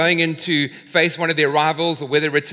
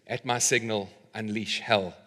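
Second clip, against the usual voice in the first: quiet, low-pitched order to the troops; low battle command; 8 semitones lower, 10 dB quieter than usual. Acted. Maximus to his troops before battle, low and quiet. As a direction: give the command softly, dropping low.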